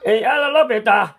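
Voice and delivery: high, loud cry of no, don't stop; shouted protest. Acted. Painter to the clowning partner, shouting in alarm. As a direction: yell it out in alarm.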